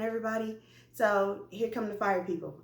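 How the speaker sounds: plain reading voice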